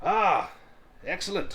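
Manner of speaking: high, loud hearty dwarf calling out; rally the tavern like a gruff showman; boisterous dwarf recruiter. Acted. Dwarf calling to the inn for brave souls, high and loud.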